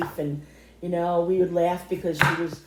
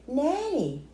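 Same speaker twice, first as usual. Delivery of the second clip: put-on little child voice; 7 semitones higher than usual. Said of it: "and she said".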